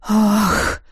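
relieved exhale; sigh of relief. Acted. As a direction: let the breath out in relief. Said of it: "вздох облегчения".

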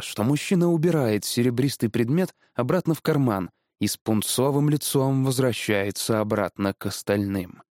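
ordinary delivery, nothing marked

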